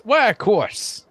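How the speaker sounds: high, slow, delighted assent; eager agreement. Acted. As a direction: swoop up high and draw it out. Florist repeats it with more enthusiasm.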